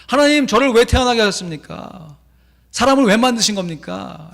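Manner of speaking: loud, high, anguished protest; complaining prayer. Retold or imitated. Carver complaining to God, loud and high. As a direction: hurl the question at God. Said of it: "원망하며 기도해요".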